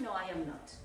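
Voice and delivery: No, I am not, said low and soft; teacher showing the negative reply; low quiet answer. Shown as a demonstration. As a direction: sink the voice for the no. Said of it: "modelling answers".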